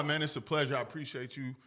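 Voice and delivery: ordinary talk, nothing marked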